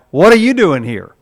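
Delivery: loud, puzzled question at the gate; loud puzzled angel. Retold or imitated. Angel at the gate loudly questioning the arriving thief. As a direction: demand it loudly like a baffled angel.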